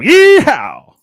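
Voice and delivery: high, loud cowboy whoop; yeehaw shout. Acted. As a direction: let out a big rodeo yell. Answering with his own yeehaw.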